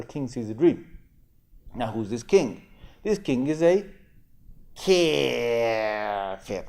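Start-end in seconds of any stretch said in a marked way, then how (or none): 4.8-6.6 kafir set apart after a long pause, in a lower, altered voice; pause, then drop kafir deliberately; pointed kafir. Shown as a demonstration. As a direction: kafir dropped low after a loaded pause. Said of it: "there's a reason why I said it like that".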